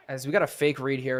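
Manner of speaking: usual speaking voice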